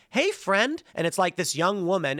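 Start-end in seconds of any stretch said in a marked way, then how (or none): 0.1-0.8 much higher, sing-song hello; mock sweet greeting. Game guide greeting the player, mocking high sweet voice. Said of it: "What they did was like".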